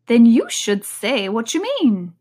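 slow, odd hare voice; March Hare voice. Acted. The March Hare lecturing Alice, slow and odd. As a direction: drag it out like a prim hare.

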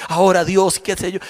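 ordinary talk

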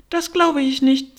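high, loud refusal; disbelieving pigeon. A narrator's reading. Pigeon rejects Alice's claim about girls, disbelieving.

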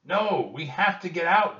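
high, loud protest; alarmed shout. A narrator's reading. Jack calling to the rowers to let them out, alarmed. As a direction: call out urgently over the noise.

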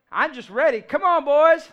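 put-on loud joking dad welcoming the boys; mock tough dad. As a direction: playfully puff up and call out the boys. Joking dad confronting daughters' boys.

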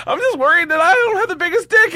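very high put-on child's voice; fretful uncle in a squeaky falsetto; high-pitched voice. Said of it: "in a high-pitched voice".

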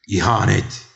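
forceful one-word exclamation; loud accusing cry. A narrator's reading. Little man echoes the king's treason cry, loudly.

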